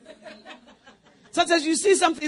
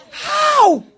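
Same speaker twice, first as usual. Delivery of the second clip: high, loud disbelieving question; incredulous; 14 semitones higher, 11 dB louder than usual. Acted. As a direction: toss out a high incredulous how. Scornful question of the leader.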